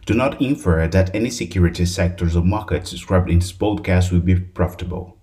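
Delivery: rapid, loud disclaimer read; fast legal disclaimer. Acted. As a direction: rattle off the legal fine print. Disclaimer read at podcast end.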